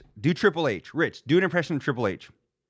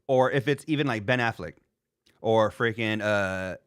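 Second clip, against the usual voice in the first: usual speaking voice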